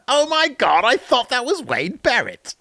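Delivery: mock Nerd Cubed impression. Host redoes his bad impression of the viewer Dan, high-pitched. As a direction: gasp it out like a fan who can't believe it. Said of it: "such a horrible impression of you".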